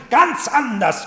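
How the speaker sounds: "Ganz anders" cried high and loud, falling at the end; loud insistence. Acted. Heinrich insists to the Marchioness about Bertha: loud, high "Ganz anders". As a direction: shout "Ganz anders" high with a hard fall.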